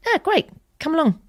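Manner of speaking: friend's breezy reply. Retold or imitated. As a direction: toss off the invitation lightly in her voice. Voicing friend's casual reply.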